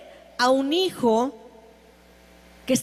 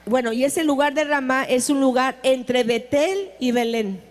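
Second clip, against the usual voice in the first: ordinary talk, nothing marked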